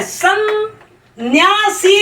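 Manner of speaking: "सन्यासी" drawn out very slowly, each sound held apart; slow spelling-out demo. Shown as a demonstration. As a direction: stretch "सन्यासी" sound by sound for the class. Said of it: "सन्यासी दो बार आ रहा है". ("सन्यासी" को बहुत धीरे, हर ध्वनि अलग खींचकर)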